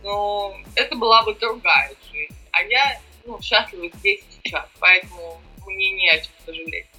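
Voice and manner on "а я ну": ordinary delivery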